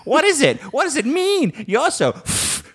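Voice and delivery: a nursing-home resident straining to remember; confused old person. Retold or imitated. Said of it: "be like".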